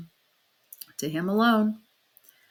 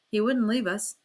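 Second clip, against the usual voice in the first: ordinary delivery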